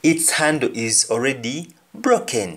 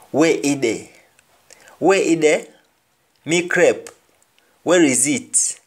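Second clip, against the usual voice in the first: "Where is it" lifted and louder, patois example; Jamaican patois; 4 semitones higher, 4 dB louder than usual. Shown as a demonstration. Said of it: "we say e or e".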